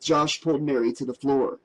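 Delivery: usual speaking voice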